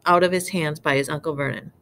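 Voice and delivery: usual speaking voice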